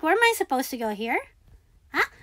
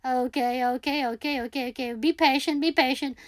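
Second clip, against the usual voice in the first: quiet self-soothing; 4 semitones lower, 8 dB quieter, faster than usual. Real, not acted. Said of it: "self-calming, quiet".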